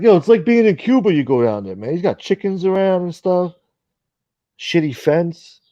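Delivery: lampoon him gawking at the chickens; mock impression. Imitating streamer describing chickens.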